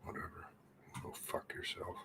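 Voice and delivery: hissed under the breath, unvoiced; bitter whisper to himself; whispered insult. Real, not acted. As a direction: mutter it so nobody hears. Muttering insult at screen.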